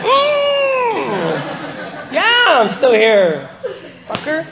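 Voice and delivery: laughing through the words; slow and laughing, defiant. Real, not acted. Laughing reply to the friend.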